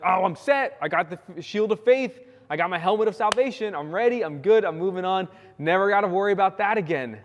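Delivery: hammed-up know-it-all; smug complacent believer. Preacher mocks believers who feel set, cocky mock voice.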